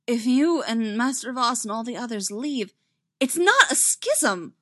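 slightly frantic protest; a little hysterical. A narrator's reading. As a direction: build to an overwrought denial. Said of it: "Ahsoka sounded a little hysterical".

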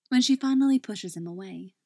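ordinary delivery

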